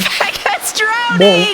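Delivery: high slow dramatic wail; mock panic. Repeats alarm dramatically.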